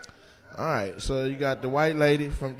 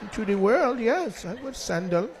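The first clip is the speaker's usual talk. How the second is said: higher, lilting mock Indian accent; imitated Indian accent; 6 semitones higher, 4 dB quieter than usual. Comic voicing an Indian guest about sandals, mock Indian accent. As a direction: mimic her in a put-on Indian accent. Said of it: "She's like".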